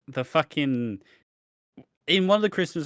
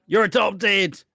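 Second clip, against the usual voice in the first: loud; 6 semitones higher, 5 dB louder, slower than usual. Retold or imitated. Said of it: "just traumatize him".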